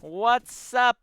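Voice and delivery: loud, high YouTuber greeting; over-the-top streamer impression; PewDiePie. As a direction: shout a hyped-up video intro. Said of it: "gonna be a video by PewDiePie".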